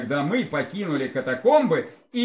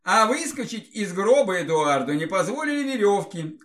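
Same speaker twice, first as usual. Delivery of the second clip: ordinary delivery, nothing marked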